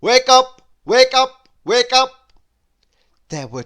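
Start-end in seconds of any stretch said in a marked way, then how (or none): 0.0-2.3 shouted, high and loud; a shout to wake someone up; loud wake-up call. A narrator's reading. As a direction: blast it to wake a sleeper. Noise keeps shouting him awake.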